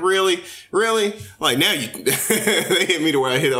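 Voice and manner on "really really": skeptical. Retold or imitated. Mocking disbelief at fake interview voice.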